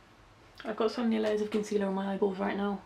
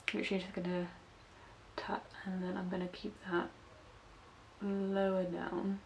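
concentrating whisper while tapping shadow; 8 dB quieter than usual. Real, not acted. Vlogger focused on her crease, whispering. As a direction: murmur under your breath as you work.

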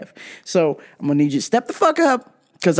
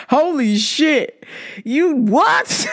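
higher, slow, stunned; disbelief; 8 semitones higher, much slower than usual. Retold or imitated. Reacting to dad's milk story, slow stunned disbelief. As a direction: drag it out in disbelief.

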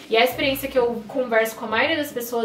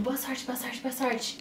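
softly wishing luck under the breath, much softer; hushed good luck; 9 dB quieter, slower than usual. Retold or imitated. People in the visa line wishing a woman luck, hushed and soft. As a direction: murmur it so the waiting room won't hear.

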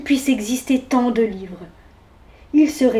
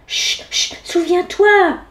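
shushing, then a higher urgent reminder; shush, then press the point; insistent; 6 semitones higher, slower than usual. A narrator's reading. Mouse reminding bear of the rule, insistent. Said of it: "insista la souris".

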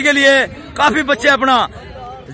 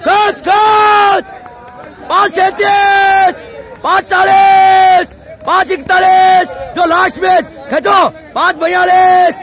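shouted time calls; 4 semitones higher, much slower than usual. Real, not acted. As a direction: stretch each number out across the ground. Coach calls times and commands. (ज़ोर से खींचकर समय की पुकार)

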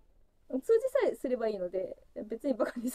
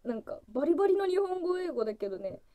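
usual speaking voice

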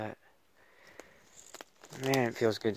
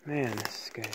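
ordinary talk, nothing marked

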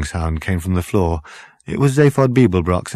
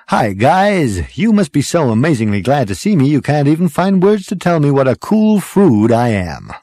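loud, higher-pitched cocky greeting; swaggering show-off; 7 semitones higher, 5 dB louder than usual. A narrator's reading. Zaphod greets his stunned friends, swaggering. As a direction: boast breezily to an audience.

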